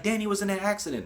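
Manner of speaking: quick, high retold alarm; alarmed report. Grandma telling her daughter the news, alarmed. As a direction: blurt it out fast and high.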